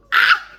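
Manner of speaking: loud high shriek; excited squeal. Real, not acted. Reader thrilled by the hot scene, squealing loud. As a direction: squeal with delight.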